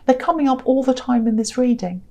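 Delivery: ordinary delivery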